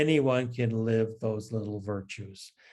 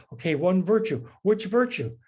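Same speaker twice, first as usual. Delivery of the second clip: put-on higher, hurried, fretting young priest; anxious young priest; 7 semitones higher, 4 dB louder than usual. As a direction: play the priest getting flustered over which virtue. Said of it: "he starts to write".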